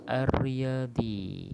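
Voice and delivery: "Ar-Riyadi" slow, doubled rolled R, no L; straight into ra. Shown as a demonstration. Teacher giving first example, "Ar-Riyadi" with doubled R. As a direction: merge the L into a long R in "Ar-Riyadi". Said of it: "Sebut bu-, ra tu ya".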